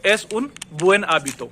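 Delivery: word-by-word "Es un buen hábito"; very slowly, separating buen and hábito; slow speech. Shown as a demonstration. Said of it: "धीरे-धीरे बोलोगे".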